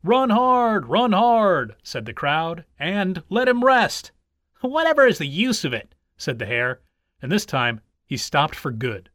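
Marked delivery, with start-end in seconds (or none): 0.0-1.8 loud, high rallying cry; cheering shout. A narrator's reading. Supporters of the tortoise urging him on, shouting. Crowd chants at runners. 3.3-4.1 high, raised-voice call; crowd cry. A narrator's reading. Crowd watching the hare sit down, calling out. 4.6-5.9 higher-pitched, fed-up question; exasperated hare. A narrator's reading. Hare sitting down for good, exasperated.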